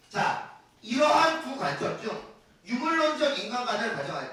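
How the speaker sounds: loud, punchy classroom delivery; energetic lecture. Acted. Teacher loudly walking students through paragraph hints. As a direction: drive the points home to the class.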